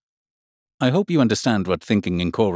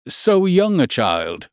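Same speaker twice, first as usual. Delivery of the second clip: lightly voiced gentleman, drawn-out and higher; slow, raised-pitch remark from an opinionated gentleman; gentleman in paper; 7 semitones higher, slower than usual. A narrator's reading. Gentleman opposite Alice remarks on her, lightly voiced and slow. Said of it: "said the gentleman sitting opposite to her".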